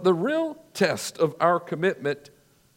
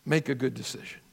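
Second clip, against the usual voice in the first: quiet appeal; 5 semitones lower, 6 dB quieter, faster than usual. Real, not acted. Preacher's quiet closing appeal.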